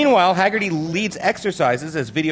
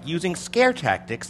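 usual speaking voice

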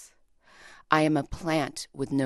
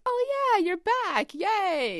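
high, bright-voiced cheer for a returning poet; excited online friend; slower than usual. Fellow poet greeting her online return, excited high voice. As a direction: squeal it like a friend welcoming you back. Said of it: "it's like".

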